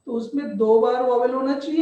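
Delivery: usual speaking voice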